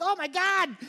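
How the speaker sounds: high, loud panicked cry; a mother's alarmed shriek; shocked gasp. Retold or imitated. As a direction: mom panicking at the news. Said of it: "my mom's like".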